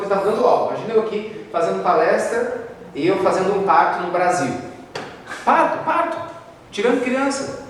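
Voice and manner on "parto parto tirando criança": acted commotion. Acting out the surprise delivery.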